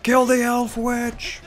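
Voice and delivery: high, urgent yell, last words stretched; alarmed battle cry. Real, not acted. Player spots Galadriel and orders troops on her, alarmed cry. Alarm at enemy hero appearing.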